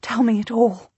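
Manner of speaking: urgent breathless whisper; desperate whisper. Acted. Queen begging the duke in secret, desperate whisper. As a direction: beg in a hushed whisper. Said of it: "she whispers desperately to him".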